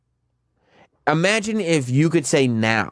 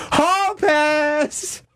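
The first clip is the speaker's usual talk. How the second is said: high, sudden cry of hall pass; a giddy high shout; excited exclaim; 14 semitones higher than usual. Real, not acted. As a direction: blurt it out gleefully. Excited exclamation about the Rock.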